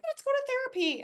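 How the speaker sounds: high mocking ex voice; mimicking fiance. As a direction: parody the fiance's suggestion. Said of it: "he was like".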